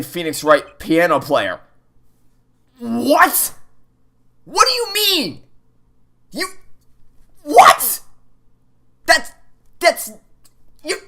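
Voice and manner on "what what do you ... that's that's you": very high, strained what do you mean; stunned squeal. Real, not acted. Player to the game, high pitched shock. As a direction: squeak it out in disbelief.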